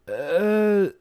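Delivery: high, slow, hesitant mare voice; a caught mare stalling for time; nervous. Acted. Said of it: "she asked nervously".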